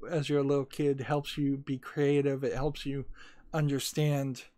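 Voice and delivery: normal talking voice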